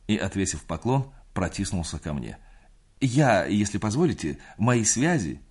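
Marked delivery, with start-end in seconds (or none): 3.0-5.4 fawning. A narrator's reading. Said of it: "с ужасно униженной, выжидающей улыбкой".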